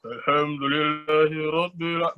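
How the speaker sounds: slow, forced-low chant. Shown as a demonstration. Teacher showing what low intonation is not, forced-low chanting. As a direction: push the recitation down deliberately low. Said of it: "Intonasi rendah itu bukan rendah-rendahin".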